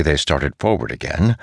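ordinary delivery

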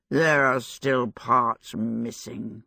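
low, slow, mushy voice of a stuffed scarecrow. A narrator's reading. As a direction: speak as if your mouth were full of damp straw. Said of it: "its mushy voice".

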